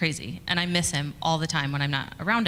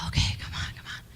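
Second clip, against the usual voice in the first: impatient mutter; 5 dB quieter than usual. Retold or imitated. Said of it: "he's just still kinda like".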